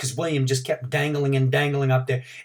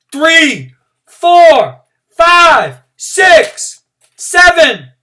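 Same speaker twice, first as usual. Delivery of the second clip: each number called much higher and louder; counting out; 14 dB louder, much slower than usual. A narrator's reading. Teacher counting each pull-up, loud calling. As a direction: ring out every count.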